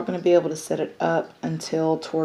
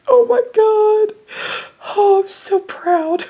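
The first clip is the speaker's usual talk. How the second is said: much higher, louder squeal of pride; real overjoyed squeal, much higher; proud squeal; 7 dB louder than usual. Real, not acted. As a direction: gush with joy. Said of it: "I'm so excited".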